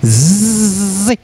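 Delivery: slow buzzing zzz like a wasp. Shown as a demonstration. Teacher imitating a wasp for the z sound: slow buzz. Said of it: "una avispa ahí cerquita".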